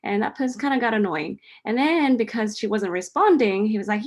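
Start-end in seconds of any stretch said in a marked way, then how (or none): none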